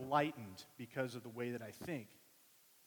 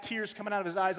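ordinary talk, nothing marked

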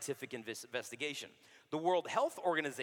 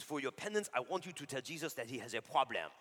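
put-on mild French priest accent; 4 semitones lower, 4 dB quieter than usual. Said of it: "he was from France".

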